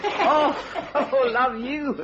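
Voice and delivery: laughing it off. Acted. Charwoman laughing off the sergeant's teasing, amused chuckle. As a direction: chuckle through the words, amused.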